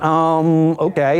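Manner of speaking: drawn-out um before a wary okay; hesitant. Retold or imitated. Wife on the phone to husband nearly home, retold hesitation. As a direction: stall a little, imitating someone holding something back. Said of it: "Huh, hesitation".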